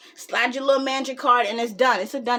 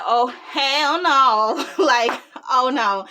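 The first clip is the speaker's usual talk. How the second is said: loud, long drawn-out refusal; comic dismay; 4 dB louder, much slower than usual. Retold or imitated. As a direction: stretch every word in mock horror. Retold dismay at the scene.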